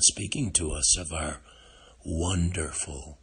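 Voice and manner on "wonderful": normal talking voice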